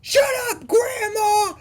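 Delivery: high-pitched mock scream; imitated shrieking outburst, voice way up high; Anthony Anderson's character screaming. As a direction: screech it like a hammed-up movie tantrum. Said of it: "Anthony Anderson's character is screaming".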